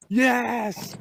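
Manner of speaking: high, loud triumphant yes; thrilled yes to a friend after the win; joyful yell. Retold or imitated. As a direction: shout it in pure triumph. Two people meet after the win.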